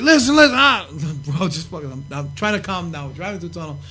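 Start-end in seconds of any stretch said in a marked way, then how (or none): 0.0-0.9 high, loud agitated protest; loud fuming. Retold or imitated. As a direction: still boiling over, shout it. Sandman still fuming in car.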